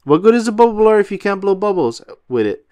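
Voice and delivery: high, loud and fast cartoon sponge; fast high SpongeBob enthusiasm; eager question. A narrator's reading. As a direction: rattle it off, bursting with excitement. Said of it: "asked SpongeBob".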